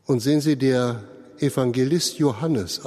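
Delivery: normal talking voice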